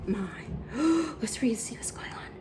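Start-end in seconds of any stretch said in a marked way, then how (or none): 1.2-2.3 hushed excited whisper. Real, not acted. Teacher whispering to children before the story.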